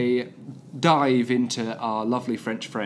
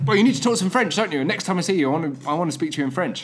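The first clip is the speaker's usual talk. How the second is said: lightly voiced bossy French friend, quick and higher; higher, hurried, insistent mimicry; imitated pushy friend; 6 semitones higher, faster than usual. French friend nagging the speaker to talk French, lightly imitated bossiness. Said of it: "'cause she's like".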